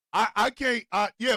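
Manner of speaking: ordinary delivery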